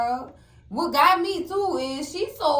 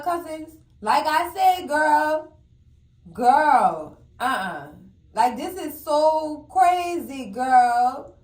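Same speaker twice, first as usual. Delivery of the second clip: usual speaking voice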